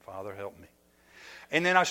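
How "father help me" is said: hushed prayer. Retold or imitated. Retold quiet prayer in anger.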